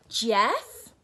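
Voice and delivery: loud call with a rise. Acted. Nora calling her missing giraffe loudly. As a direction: call for him across the garden.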